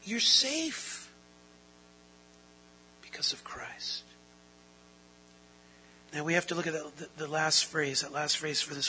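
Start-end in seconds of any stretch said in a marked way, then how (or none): 0.0-4.0 hushed assurance. Acted. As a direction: drop to a whisper on the promise. Soft assurance after a pause.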